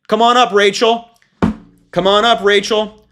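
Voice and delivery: sarcastic game show host summoning the next contestant; mock game show host. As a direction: call her up like a sneering quiz host.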